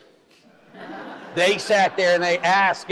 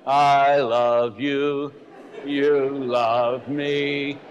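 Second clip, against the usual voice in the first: very slow, drawn-out Barney singsong; 3 semitones lower, much slower than usual. Retold or imitated. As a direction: croon it like the purple dinosaur. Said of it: "Remember Barney, the big purple dinosaur?".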